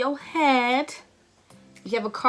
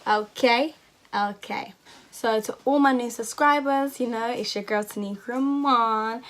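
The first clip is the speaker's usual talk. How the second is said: loud, bouncy American vlogger accent, mock sassy; put-on American accent; 3 dB louder than usual. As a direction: play up an American YouTuber accent for the outro. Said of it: "I keep doing this accent".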